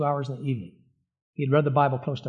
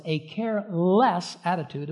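"careless" split into CARE and LESS, both stressed; care-less as two words; 3 semitones higher than usual. Shown as a demonstration. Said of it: "not careless".

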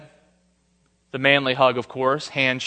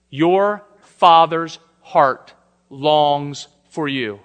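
slow emphatic; 4 dB louder, much slower than usual. Real, not acted. Preacher drives home central point.

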